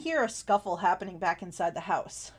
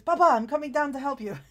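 put-on a touch louder, eager young man; lightly voiced son; 4 semitones higher, 6 dB louder than usual. Son calls down to injured father, lightly voiced eagerness. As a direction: call down eagerly to his father. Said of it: "and he says".

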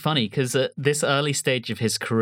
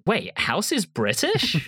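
slow, higher, disbelieving American double take; mock American surprise; 7 semitones higher, slower than usual. Co-host imitating baffled Americans, slow and higher. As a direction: stretch it out like a stunned TV fan. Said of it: "Americans would go".

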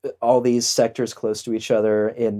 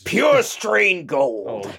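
slow mock proclamation; 7 semitones higher, much slower than usual. Mock slogan.

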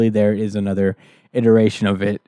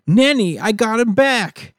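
higher, put-on Nanny voice; high mock Nanny voice; 10 semitones higher than usual. Podcaster doing Nanny returning with the baby, high put-on voice. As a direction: squeak it out like a smug villain.

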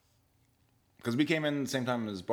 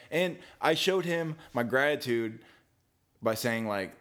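usual speaking voice

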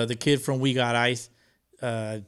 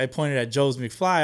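ordinary talk, nothing marked

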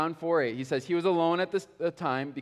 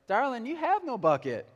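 high, sugary southern belle; playful southern-accented woman's voice; sweet southern accent; 9 semitones higher, 3 dB louder than usual. Acted. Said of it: "She's responding in her southern accent".

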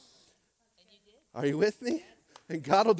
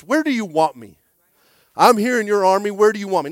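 loud prayer; 14 dB louder than usual. Acted. Voicing prayer to God.

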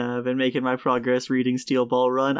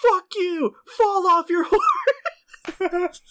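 quote lost in laughter; much slower than usual. Retold or imitated. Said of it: "tell yourself".

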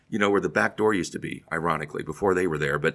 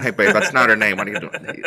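Dennis Miller impression; 6 dB louder than usual.

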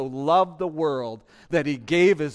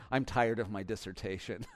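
laughing aside; 6 semitones lower, 9 dB quieter than usual. Real, not acted. Laughing aside about dissertation.

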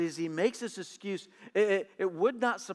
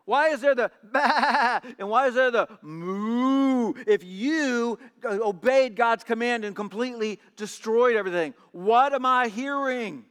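louder, incredulous demand with a laughed bah and moo; 4 semitones higher, 4 dB louder than usual. A narrator's reading. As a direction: scoff at the excuse while pressing him. Samuel keeps demanding, mocking animal sounds.

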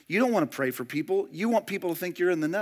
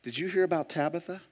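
mock gossip; 3 semitones lower, 8 dB quieter than usual. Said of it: "And we say things like".

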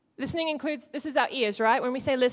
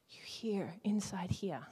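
whisper; 2 semitones lower, 6 dB quieter, slower than usual. Shown as a demonstration. Teaching inner hearing.

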